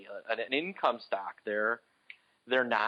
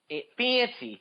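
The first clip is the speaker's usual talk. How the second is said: Chicago accent; 9 semitones higher than usual. Shown as a demonstration. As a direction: stretch the "a" in fancy the Chicago way. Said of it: "can you hear my Chicago accent there?".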